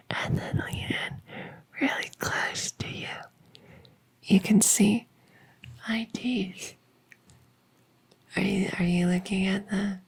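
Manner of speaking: hushed, breathy secret-sharing whisper; intimate whisper. Acted. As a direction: lean in and whisper close to their ear. Leaning in close to show teeth.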